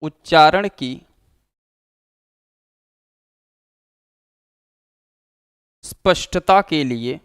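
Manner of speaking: teacher dictating notes, one phrase at a time; dictation pace. Shown as a demonstration. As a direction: read it out phrase by phrase for students to write down.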